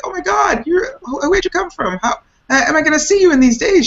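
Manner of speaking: high, excited, tumbling questions; surprised greeting. Retold or imitated. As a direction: re-enact gushing surprise at a friend's arrival. Acts out surprised greeting of friend.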